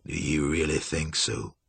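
low, softened challenge; cool, menacing Julian. A narrator's reading. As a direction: ask it low and quietly. Julian coolly challenges Cynthia.

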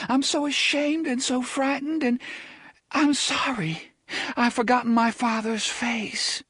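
put-on a girl confessing her shame; ashamed young woman. A narrator's reading.

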